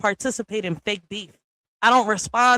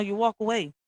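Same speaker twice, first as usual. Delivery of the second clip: ordinary delivery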